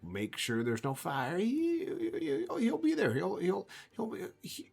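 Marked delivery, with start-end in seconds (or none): 1.4-4.7 much higher, halting, stuttering voice; stammering simple man. Acted. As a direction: stumble over the words, pitched way up. GM voicing Baron's friend.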